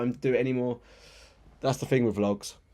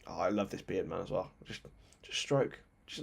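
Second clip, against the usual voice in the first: soft murmur; 4 semitones lower, 8 dB quieter than usual. Real, not acted. Admiring a fan on camera.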